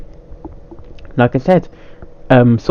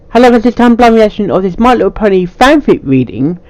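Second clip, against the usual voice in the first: higher, brighter YouTube greeting; cheery channel intro; upbeat intro voice; 8 semitones higher than usual. Acted. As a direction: sell the opening like a host. Intro take.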